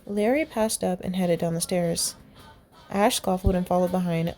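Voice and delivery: usual speaking voice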